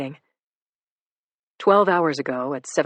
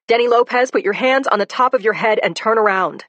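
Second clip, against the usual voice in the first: loud police order, raised pitch; commanding shout; 6 semitones higher, 7 dB louder than usual. Acted. Cop ordering suspect to turn around, loud command. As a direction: bark the arrest order at the suspect.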